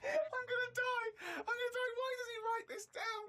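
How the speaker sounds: dying of laughter for real; hysterical laughter. Real, not acted.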